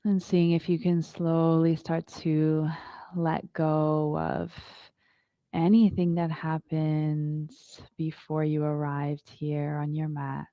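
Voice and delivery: calm, unhurried yoga teacher voice; guided meditation. Acted. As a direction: guide the class into stillness. Leads a yoga breathing meditation.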